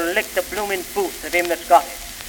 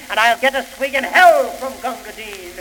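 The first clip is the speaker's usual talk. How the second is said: usual speaking voice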